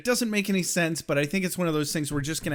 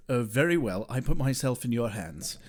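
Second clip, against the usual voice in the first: lower, resigned put-on British voice; mock English accent; 6 semitones lower, 3 dB quieter than usual. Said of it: "And he's like".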